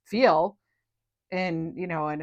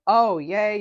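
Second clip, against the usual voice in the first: usual speaking voice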